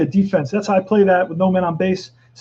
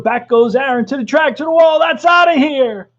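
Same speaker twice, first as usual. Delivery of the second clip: building from excited to a shout, higher and louder; home run call; 8 semitones higher, 5 dB louder than usual. Acted. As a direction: rise to a full-throated home run shout. Calling a home run live.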